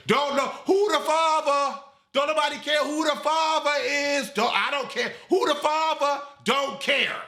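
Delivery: exasperated rant. Acted. Ranting about Spider's storyline.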